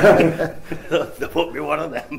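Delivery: laughing. Real, not acted. Answering the host about an engagement, laughing.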